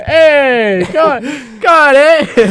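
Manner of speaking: hammed-up Canadian "come on, eh" with a laugh; Canadian accent. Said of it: "Why did he just become Canadian?".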